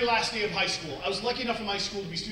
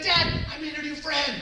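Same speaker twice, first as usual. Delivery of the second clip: put-on higher-pitched kid bursting with news; excited daughter's announcement; excited child; 7 semitones higher than usual. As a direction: play a little girl thrilled to tell Dad. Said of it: "she comes back all excited".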